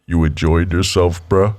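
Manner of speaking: deep gruff uncle voice; low gruff voice. Retold or imitated. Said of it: "Your voice just gets lower and you drop your brow".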